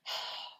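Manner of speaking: a quiet resigned exhale; soft breathy sigh. A narrator's reading. Peppermint Patty giving up her seesaw idea, soft sigh. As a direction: let out a small disappointed sigh. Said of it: "Peppermint Patty sighed".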